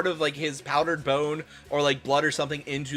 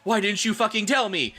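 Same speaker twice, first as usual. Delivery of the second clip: shouted; 7 semitones higher than usual. A narrator's reading. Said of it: "he shouted".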